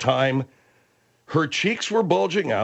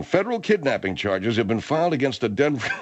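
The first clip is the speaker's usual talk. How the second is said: normal talking voice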